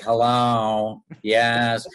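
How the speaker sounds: lightly mimicked casting director answering the phone; a touch of caricature on a curt phone greeting; Warren Pincus. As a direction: imitate how he talked. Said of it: "he talked like that".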